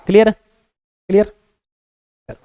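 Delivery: ordinary talk, nothing marked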